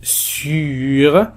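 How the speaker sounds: "sur" with the French u held long; drawn-out u. Shown as a demonstration. Teacher presenting the u sound, "sur" with its vowel drawn out. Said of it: "وصوت U".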